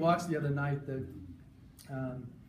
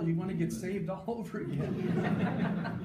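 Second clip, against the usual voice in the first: laughing; 5 semitones higher, slower than usual. Real, not acted. Laughs while praising dad's teaching.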